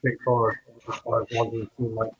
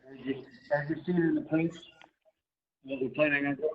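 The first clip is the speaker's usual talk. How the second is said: choppy bad phone line; breaking up on a weak connection; cutting in and out; 4 semitones higher, 4 dB quieter than usual. Real, not acted. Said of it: "you're cutting in and out".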